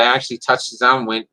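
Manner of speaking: normal talking voice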